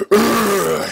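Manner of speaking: high, loud falling groan; a strained groan of effort. A narrator's reading. Villain groans as he speeds up, a loud high groan. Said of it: "he groaned".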